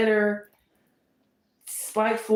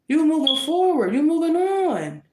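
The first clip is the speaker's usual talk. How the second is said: higher, louder, pumped-up encouragement; excited; 8 semitones higher, 5 dB louder than usual. Real, not acted. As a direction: cheer them on. Cheering the Libra's progress.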